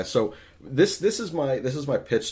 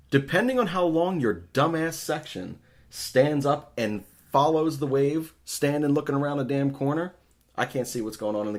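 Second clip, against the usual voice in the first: higher, louder, fed-up griping; exasperated rant; 6 semitones higher, 3 dB louder than usual. Real, not acted. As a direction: rant in real exasperation at the crowd. Ranting about fans doing the wave.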